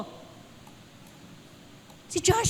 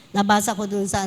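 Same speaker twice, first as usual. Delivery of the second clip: ordinary talk, nothing marked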